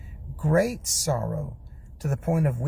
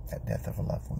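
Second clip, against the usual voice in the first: hushed; 4 semitones lower, 7 dB quieter, faster than usual. Acted. Sorrowful mention of loss.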